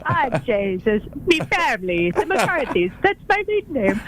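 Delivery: mock Irish lilt drifting off; hammed Irish that slips toward Italian; playful put-on Irish accent; Irish brogue. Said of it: "I'm sounding more Italian".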